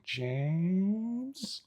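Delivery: long high rising call, trailing soft; worrying rising "James?". Retold or imitated. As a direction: stretch "James?" upward like something's wrong. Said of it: "that was the first funny thing".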